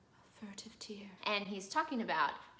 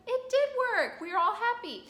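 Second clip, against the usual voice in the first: very high, loud, chirpy; high loud joy; 13 semitones higher, 9 dB louder than usual. Acted. The women rejoicing, very high loud chirp. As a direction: squeal it together happily. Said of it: "Adina and Emerina are like".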